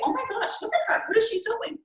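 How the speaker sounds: high, giggly mock shock at another woman; mock gossiping gasp. Mocking how people judge a girl, high amused gasp. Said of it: "we're not gonna say".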